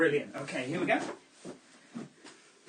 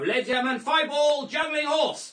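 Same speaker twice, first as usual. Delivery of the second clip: showman announcer; 8 semitones higher, 5 dB louder than usual. Acted. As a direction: bellow it to the back row. Grand announcement to audience.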